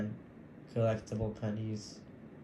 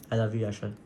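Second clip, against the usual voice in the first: ordinary talk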